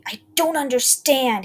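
slow, loud furious scream; drawn-out enraged scream. A narrator's reading. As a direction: scream the words in rage. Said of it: "he screamed".

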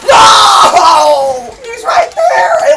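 long, high howl of frustration; gamer's drawn-out scream of protest; anguished yell. Real, not acted. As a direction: wail loudly in disbelief, drawing it out. Loses target in game.

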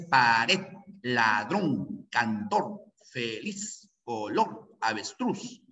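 pared, ladrón, cantor, feliz, color, avestruz each stressed on the final syllable; word by word, lean on the last syllable of pared, ladrón, cantor, feliz, color, avestruz. Shown as a demonstration. Teacher showing students where the voice's force falls, final syllables stressed in pared, ladrón, cantor, feliz, color, avestruz. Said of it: "Fíjate la fuerza de voz ahí".

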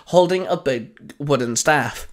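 ordinary delivery

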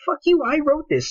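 mock outraged author. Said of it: "You're like".

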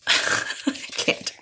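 whispered giggle; breathless laughing can't. Real, not acted. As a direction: giggle out of control. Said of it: "I can't control myself".

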